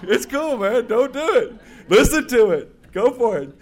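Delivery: laughing, high and loud; amused reply. Retold or imitated. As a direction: laugh it off as the other members. Voicing amused members' reply.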